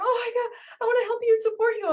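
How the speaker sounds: high, fast, overeager gush; gushing water sign. Retold or imitated. Teacher mocking an emotional water sign, high fast gush. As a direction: rush in high like you're desperate to help. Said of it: "water sign getting all emotional and going".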